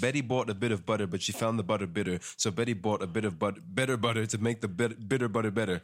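fast, running the twister together; in one big flow. Shown as a demonstration. Co-host reading the tongue twister fast, on request. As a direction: rattle it off in one breath. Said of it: "try to say it in one big flow".

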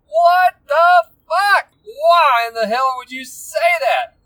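drawn-out, high-pitched incredulous yell; stunned outburst. Retold or imitated. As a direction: shout it slowly in disbelief, hitting every word. Acting out his stunned reaction.

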